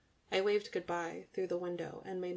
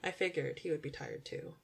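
plain reading voice

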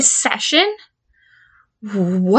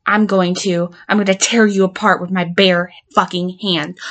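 usual speaking voice

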